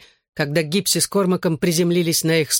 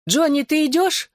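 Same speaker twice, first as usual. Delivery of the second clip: much higher-pitched, mildly worried question; uneasy question; 12 semitones higher than usual. A narrator's reading. Girl checks if the narrator is leaving, mildly uneasy. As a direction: ask with a lifted, slightly anxious pitch. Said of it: "беспокойно поглядывая на нас".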